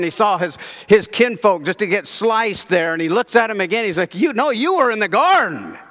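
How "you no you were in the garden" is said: insistent, higher-pitched accusation; single him out, voice rising high; accusing. Acted. Kinsman recognizing Peter from the garden, accusing.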